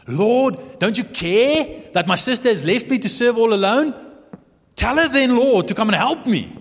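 high, loud exasperated plea; frustrated Martha. Acted. Martha complains to Jesus about her sister, exasperated. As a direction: demand help in exasperation. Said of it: "you can hear the frustration in Martha's voice".

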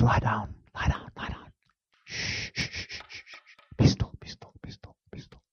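whispered command, dying away; calm whisper to storm. Retold or imitated. Christ calming the storm, whispered. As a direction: hush the storm without shouting. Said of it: "doesn't jump up ... screaming".

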